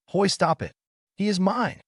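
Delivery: high, possessive growl. A narrator's reading. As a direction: warn her off, growling. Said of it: "Yodajimai said with a growl".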